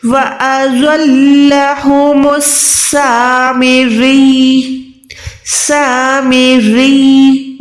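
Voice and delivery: slow chanted recitation; Quran recitation. Shown as a demonstration. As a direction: recite slowly in tajweed chant. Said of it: "अभी मेरे साथ पढ़े". (धीमी तिलावत का अंदाज़)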